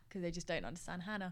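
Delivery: ordinary talk, nothing marked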